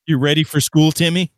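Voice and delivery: mock mom call. Mocking the edgelord hunter.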